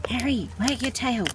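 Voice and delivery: high sing-song coaxing of a pet; squeaky praising a dog. Real, not acted. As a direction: coax the dog brightly.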